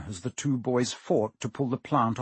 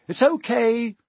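high call up the shaft; 10 semitones higher, 5 dB louder than usual. A narrator's reading. Harry calling up to Ron through the trap door, raised call. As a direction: call up to a friend above. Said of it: "he called up to the light".

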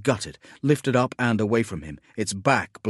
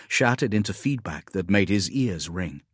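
ordinary delivery, nothing marked

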